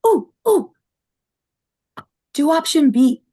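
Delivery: high, loud eager interjection; excited. Acted. Dahlia leaning over Sebastian's gamebook, excited. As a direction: butt in eagerly, pitch jumping up. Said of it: "leans over and she goes".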